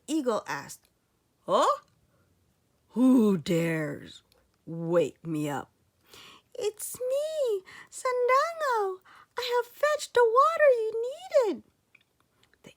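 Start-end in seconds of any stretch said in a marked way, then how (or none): 1.5-5.7 low, loud eagle demanding; a great bird roused and displeased; grumpy eagle. Acted. Eagle woken from sleep. 6.5-11.6 put-on high, quick boy voice; a small boy rushing to explain; boy reporting to the eagle, high and fast; eager boy.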